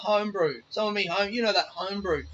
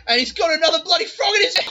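much higher and louder, gleeful; punchline burst; 12 semitones higher, 8 dB louder, faster than usual. Retold or imitated. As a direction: land the punchline with glee. Punchline of grandpa's joke.

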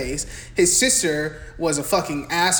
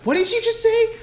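much higher, louder outraged mother; shrill outraged mom; 4 dB louder, faster than usual. Retold or imitated. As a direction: shriek it as the offended mom. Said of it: "the fucking mom's like".

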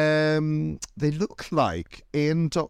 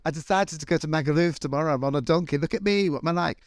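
giddy holiday friend; 6 semitones higher than usual. Retold or imitated. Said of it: "those friends that you see go".

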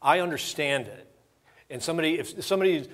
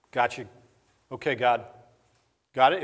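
usual speaking voice

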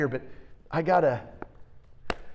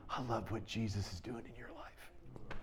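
much softer, hushed quote of a moved man; soft tearful quote; 4 semitones lower, 18 dB quieter than usual. Retold or imitated. Old teacher embracing him, speaking hushed and moved. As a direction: drop almost to nothing, a touch tearful.

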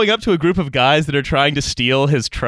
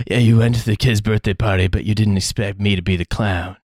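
Steven Seagal voice; 6 semitones lower than usual. Retold or imitated. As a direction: growl it like Seagal. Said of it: "Seagal would be something like".

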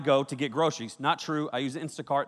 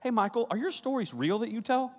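lightly voiced, higher, softer guest; curious lady; 6 semitones higher, 5 dB quieter than usual. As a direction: ask it like a skeptical dinner guest. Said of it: "she said".

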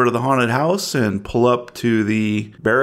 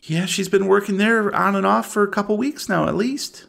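lightly voiced anxious mother, pitched up; a mother asking the agents, higher; a hint of anxious small-town woman; worried mother; 10 semitones higher than usual. Game master voices worried mother.

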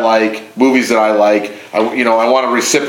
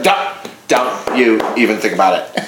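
a hint of the ex, lower and warning; ex-girlfriend impression; 4 semitones lower than usual. Man imitating his ex-girlfriend refusing his show, lightly voiced. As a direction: lightly play the ex shutting the idea down. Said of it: "impression of her that was dead on".

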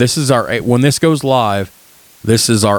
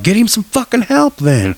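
irritated boss; 11 semitones higher than usual. Retold or imitated. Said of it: "was like".

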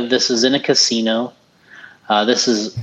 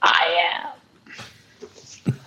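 mock Pete Weber; 5 semitones higher, 4 dB quieter than usual. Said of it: "Who do you think you are?".

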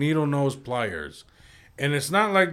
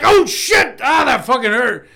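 re-enacted high yell, easing off; pained shout; 7 semitones higher, 6 dB louder than usual. Telling friends how the tug hurt, acted pained shout. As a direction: shout it out like a sudden sharp hurt. Acts out his reaction to pain.

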